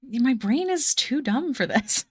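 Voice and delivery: ordinary talk, nothing marked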